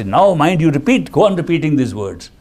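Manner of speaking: loud, insistent order; commanding. Acted. Meditator ordering the wandering mind to repeat, loud and firm.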